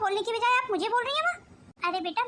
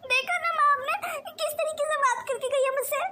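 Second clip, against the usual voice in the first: higher-pitched, hurt appeal; upset complaint; 4 semitones higher than usual. Acted. Daughter complains to her mother about the sister-in-law, upset. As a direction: complain to mother, wounded and worked up. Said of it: "अरे तू चिंता क्यों कर रही है".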